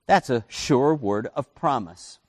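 usual speaking voice

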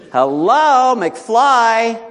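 high, loud, teasing call; mocking wake-up call. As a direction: call out as if to someone dense. Film catchphrase to wake congregation.

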